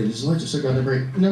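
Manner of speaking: ordinary talk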